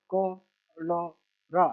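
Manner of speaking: very slow, each syllable held apart. Shown as a demonstration. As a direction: sound it out piece by piece for a learner. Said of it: "छोटा सा letter पढ़ लेना".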